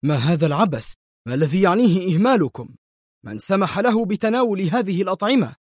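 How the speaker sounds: high, sharp scolding doctor; an enraged doctor scolding the staff; furious rising demand; angry outburst. A narrator's reading. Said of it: "وهو يهتف في حجرة العناية المركزة بغضب".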